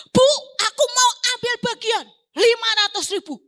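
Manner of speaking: berteriak tinggi dan keras; teriakan gembira. Retold or imitated. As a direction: serukan dengan semangat dari jauh. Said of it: "keluar dari kamar mandi teriak". (teman berteriak gembira)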